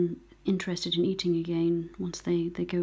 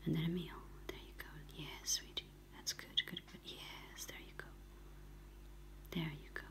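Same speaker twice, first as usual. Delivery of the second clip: gentle cooing whisper; 6 dB quieter than usual. Real, not acted. Rescuer coaxes a goldcrest to take glucose, hushed cooing. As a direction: coo to the bird under your breath. Feeding the bird, soft praise.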